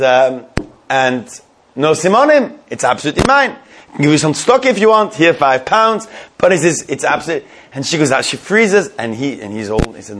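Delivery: ordinary talk, nothing marked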